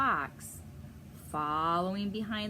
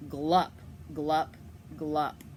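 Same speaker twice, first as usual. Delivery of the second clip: low, loud glup glup; gulping sinking sounds; 3 semitones lower, 4 dB louder, much slower than usual. Acted. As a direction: sink him down gulping. Stranger sinking under water.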